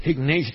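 "Ignacio" said the English way, ig-NAY-shee-oh; "Ignacio" with a light American sh sound; English-style name. Retold or imitated. Mom Betty greeting the preacher, English pronunciation. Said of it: "Mom Betty así me dice".

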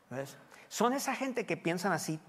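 ordinary talk

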